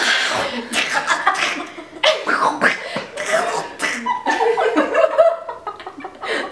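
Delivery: high, helpless laughing fit; long high cackling fit. Real, not acted. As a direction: laugh till it squeals like a cat. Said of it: "Sounds like my cat".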